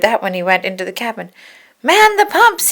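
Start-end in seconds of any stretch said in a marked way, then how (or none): 1.8-2.7 high, loud alarm command; rally the crew in panic; shouted order. A narrator's reading. Chip ordering the pumping, a high loud shout. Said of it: "he cried".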